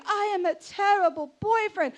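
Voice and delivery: loud, high mock confession; hammed-up boyfriend owning his guilt; remorseful boyfriend. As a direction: proclaim it like a melodramatic apology.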